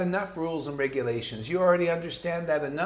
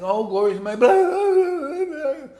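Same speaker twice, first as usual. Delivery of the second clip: gushing devotee; 10 semitones higher, 6 dB louder than usual. Retold or imitated. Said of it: "Lalita Ganga saying".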